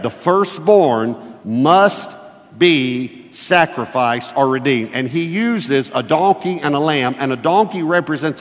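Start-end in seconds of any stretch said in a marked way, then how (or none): 0.0-4.9 measured sermon point, weight on "must"; emphatic point one. Shown as a demonstration. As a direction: declare it slowly like a headline. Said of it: "this is what we just read".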